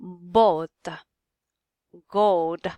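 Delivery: "boat" and "gold" with a long Middle English O; deliberate, separated "boat", "gold" with long O. Shown as a demonstration. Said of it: "now on our long O's".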